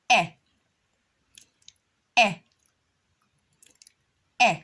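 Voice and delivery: "Eh" as a crisp short e; short e sound. Shown as a demonstration. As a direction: model "Eh" clearly for the class. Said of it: "That's the short E sound".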